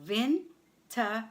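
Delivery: "Winterzeit" broken as Win, ter, zeit with pauses; syllable by syllable. Shown as a demonstration. Teacher breaks the German word down for learners, syllable by syllable. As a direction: separate each syllable of "Winterzeit" clearly.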